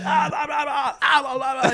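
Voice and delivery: high-pitched gibberish rant; mock yelling. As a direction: imitate an irate man yelling nonsense sounds. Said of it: "the guy's yelling".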